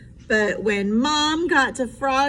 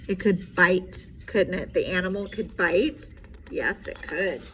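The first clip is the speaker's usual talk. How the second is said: plain reading voice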